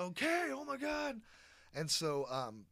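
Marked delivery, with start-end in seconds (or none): 0.0-1.2 mock freak-out. Jay freaked out retold.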